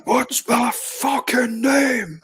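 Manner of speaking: high, drawn-out demand; acted film line. Re-enacting a loved film scene, drawn-out mock demand. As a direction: stretch each word in a high mock cry.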